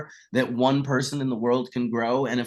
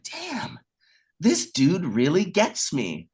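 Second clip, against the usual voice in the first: delighted realization; 5 semitones higher, slower than usual. Retold or imitated. Smelling the jar Jolly Roger passed him, delighted and slow. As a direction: savour the moment you feel understood. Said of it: "was like".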